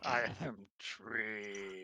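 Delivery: low, very slow, soft tree creature. Pretend. Game master echoing the tree player, slow lumbering tree voice. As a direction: drag out each word like a giant tree.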